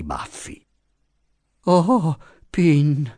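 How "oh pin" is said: pitiful sigh. A narrator's reading.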